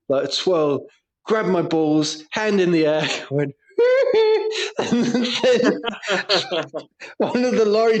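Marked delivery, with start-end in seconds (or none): 3.8-4.7 [name]. Retold or imitated. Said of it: "full-on three sixty [name] thing".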